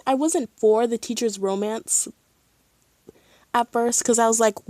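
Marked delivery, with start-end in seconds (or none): none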